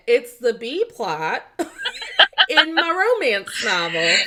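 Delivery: high, loud retold classroom announcement. Student blurting to teacher in class, loud retold announcement. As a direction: proclaim it loudly, mock-earnest, with a laugh breaking in. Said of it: "Just, like, out loud in class".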